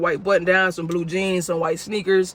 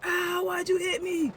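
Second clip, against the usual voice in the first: high, slow, soft whimper; whiny hurt man; 11 semitones higher, 7 dB quieter, slower than usual. Retold or imitated. Thief complaining to the narrator about being hit. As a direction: whine it out like a beaten man.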